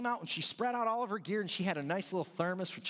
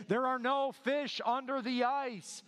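slow, higher booming voice from all around; slow heavenly proclamation, higher pitched; 5 semitones higher, slower than usual. Retold or imitated. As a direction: boom it down from heaven. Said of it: "this voice coming from all around her".

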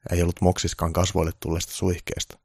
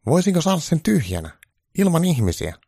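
much higher, loud pleading voice; a needy caricature of Trump; 11 semitones higher, 6 dB louder than usual. Acted. Trump begging Santa for good news, high-pitched.

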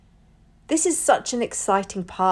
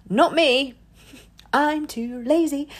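playful; 7 semitones higher, 3 dB louder, slower than usual. Real, not acted. Joking about not editing.

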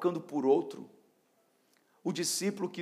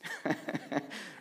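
soft laugh; 7 dB quieter than usual. Real, not acted. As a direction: laugh quietly at your own joke. Amused by own Walmart joke.

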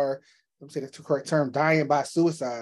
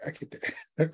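whispered; 4 semitones higher, 5 dB quieter, slower than usual. Real, not acted. Echoing guest, amused.

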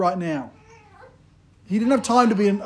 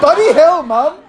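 higher and louder, through laughter; kid's cheeky curse; 6 semitones higher, 6 dB louder than usual. Retold or imitated. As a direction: copy a little boy swearing at mum. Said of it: "he was like".

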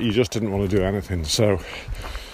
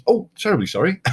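high, fluty British politeness; mock-British sorry, much higher; very British apology; 9 semitones higher, faster than usual. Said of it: "you know, in a very British way".

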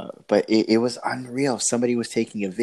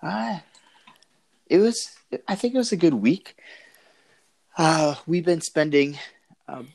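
breaking up; 7 semitones higher than usual. Real, not acted. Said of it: "it's really bad".

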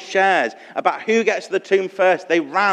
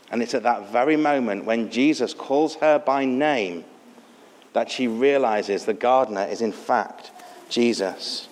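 ordinary delivery

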